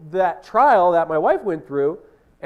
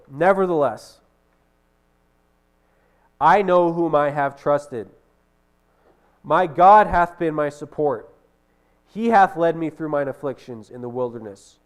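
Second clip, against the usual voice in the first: slow, louder declaiming of the verse; proclaiming scripture; 5 dB louder than usual. Acted. Preacher declares Nephi's trust in God, slow and louder. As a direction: lift the words up in triumph.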